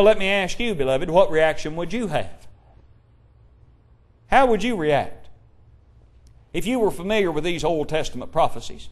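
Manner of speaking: ordinary delivery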